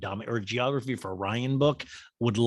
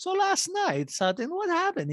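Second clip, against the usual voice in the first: Diana's accent impression. Host imitating Diana's talking head, very high Bosnian accent. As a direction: deliver it in a put-on Bosnian accent, deadpan. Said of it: "Diana says".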